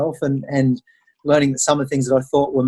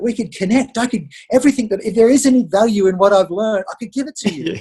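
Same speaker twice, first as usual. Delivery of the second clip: real excitement; 5 semitones higher, 3 dB louder than usual. Real, not acted. Father excited about sharing journey.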